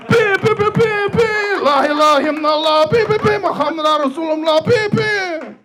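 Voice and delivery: very high buzzing horn blasts over chanted words; reggae horn imitation. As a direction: blare it like a reggae air horn. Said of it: "That was a reggae horn".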